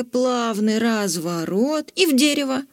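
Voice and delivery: plain reading voice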